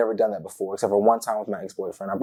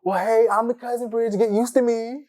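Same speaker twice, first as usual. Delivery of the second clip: much higher, bubbly intro; excited cousin; 13 semitones higher than usual. Retold or imitated. Cousin Bridget greeting the date, excited high voice. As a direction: brag playfully, all welcome. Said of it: "She was like".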